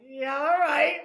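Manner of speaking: high, loud mock disbelief; sarcastic brother. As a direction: sneer it like a doubtful brother. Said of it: "he was like".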